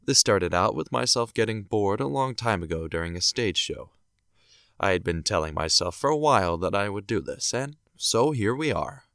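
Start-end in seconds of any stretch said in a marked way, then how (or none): none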